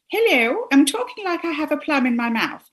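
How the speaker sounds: high, hammed-up upper-class English accent; posh plummy accent. Guest mocking the privately educated elocution voice, posh plummy accent. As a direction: talk posh, as if with a plum in the mouth. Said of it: "would have this talking".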